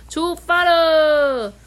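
high, loud, slow call; calling out departure. Acted. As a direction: sing out the departure. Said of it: "驾驶员啊，重新启动引擎，说".

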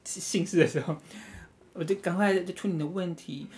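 ordinary delivery

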